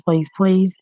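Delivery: normal talking voice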